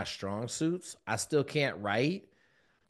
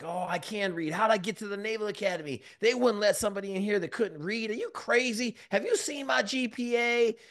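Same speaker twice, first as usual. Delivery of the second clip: high, quick indignant denial, half mocked; rehearsed denial; 10 semitones higher than usual. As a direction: rattle off a defensive excuse. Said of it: "I had this rehearsed speech".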